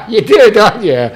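loud, high, laughing jab; laughing tease. Real, not acted. Speaker to crowd about drunks' Saturday nights, laughing tease.

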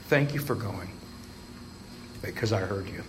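soft, heartfelt thanks; sincere. Real, not acted. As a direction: thank them from the heart, softly.

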